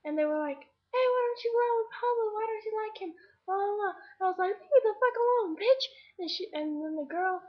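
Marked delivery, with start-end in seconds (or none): 0.6-3.9 high-pitched mocking imitation of nagging; mock badgering. Suitor's friends badgering the girl, high and mocking. 4.5-5.8 high, loud acted telling-off; retold angry outburst. Girl telling off the suitor's friends, loudly angry. Retells snapping at the group.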